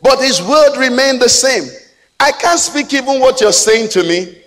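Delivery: high, loud pulpit preaching; impassioned preacher in full cry; full-force preaching. Acted.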